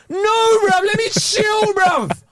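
high wailing plea; exasperated cry. Acted. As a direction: whine it out, begging to rest. Comic plea to relax.

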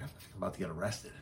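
hushed warning. Retold or imitated. Handing kit before arrest.

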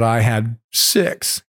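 normal talking voice

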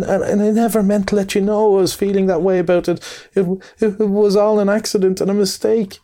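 high, stammering little mole, sobbing out his lost home; a heartbroken small creature stuttering through sobs; tearful Mole. A narrator's reading. Mole pleads through tears.

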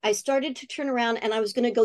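ordinary talk

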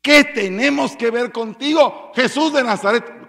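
loud, high, slow shout; demon shouting. A narrator's reading. Possessed man yelling at Jesus, loud and high. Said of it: "gritó a gran voz".

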